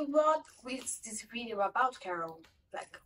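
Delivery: ordinary delivery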